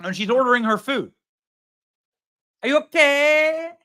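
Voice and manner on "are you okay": higher, drawn-out fake Mexican accent; mock Mexican accent. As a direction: ham up a mother-in-law's Mexican accent while ordering. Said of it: "starts speaking in a Mexican accent".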